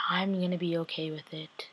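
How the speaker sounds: hushed, breathy whisper. Real, not acted. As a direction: whisper it like a secret complaint. Upset fan confides.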